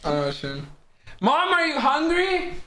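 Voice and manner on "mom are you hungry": higher, slow call to mom. Real, not acted. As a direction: call across the house. Calling out to mom.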